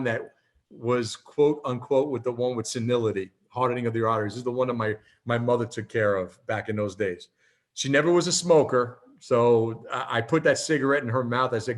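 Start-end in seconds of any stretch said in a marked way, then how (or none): none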